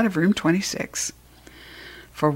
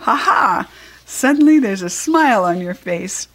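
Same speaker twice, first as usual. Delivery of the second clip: proud laugh, loud; beaming with pride; 3 semitones higher, 5 dB louder than usual. Acted. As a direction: laugh proudly at the smiling hamster. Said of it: "Aldo beamed with pride".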